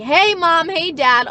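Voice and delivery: high, loud cheery hey mom; mock barging-in greeting. She mocks barging into parents' room, loud and high. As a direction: burst in sing-song. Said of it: "barging into your room like".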